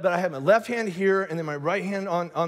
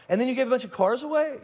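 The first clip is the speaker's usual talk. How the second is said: acted astonishment, raised voice; mock disbelief; 5 semitones higher than usual. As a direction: ask as a baffled onlooker, pitched up.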